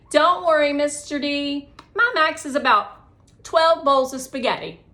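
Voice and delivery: put-on loud, high bragging kid voice; boastful kid. Connor boasting to his teacher, loud cheerful brag. Boy boasting about eating.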